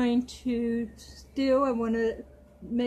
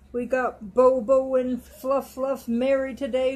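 ordinary talk, nothing marked

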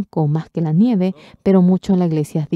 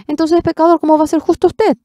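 challenging question; 12 semitones higher, 6 dB louder than usual. Retold or imitated. Preacher mimics pastors' challenge.

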